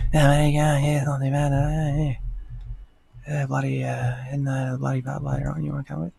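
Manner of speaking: a lazy, mumbled Australian bogan chat; Aussie bogan slur. Retold or imitated. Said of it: "it's just like a slur of noises".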